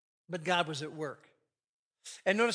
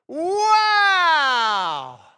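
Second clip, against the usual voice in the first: very loud, much higher drawn-out wow; amazed wow; 9 semitones higher, 16 dB louder than usual. Acted. Adam staring at Eve, a soaring amazed wow. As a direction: cry it out in wonder. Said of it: "Same thing backwards".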